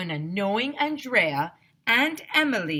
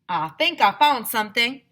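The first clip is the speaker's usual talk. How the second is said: louder southern miner excitement; Mister Burke finding something, louder; excited find; 5 semitones higher, 9 dB louder than usual. A narrator's reading. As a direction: announce the find. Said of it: "he said".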